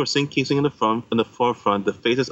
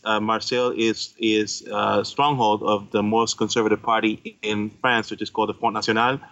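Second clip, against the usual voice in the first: normal talking voice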